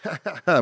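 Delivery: high mock "ha ha ha"; imitated laugh. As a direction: imitate another man's laugh. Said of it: "he laughs. He goes".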